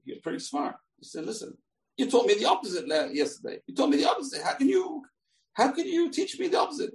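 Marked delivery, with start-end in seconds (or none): 1.3-6.9 high-pitched, incredulous complaint; baffled protest. Acted. Confused pupil challenging his teacher's reversed lesson, high indignant protest.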